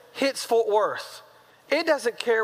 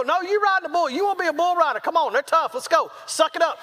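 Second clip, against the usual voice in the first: raised pitch, pushy pep talk; mock stern father; insistent tough-love dad; 6 semitones higher than usual. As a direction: order a balking kid onward. Dad ordering his son to ride.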